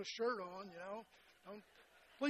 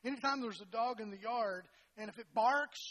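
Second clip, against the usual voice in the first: ordinary talk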